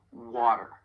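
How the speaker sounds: American "water" with a flapped T. Shown as a demonstration. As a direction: soften the T in "water" to a quick tap. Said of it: "you hear a flap T when I say it".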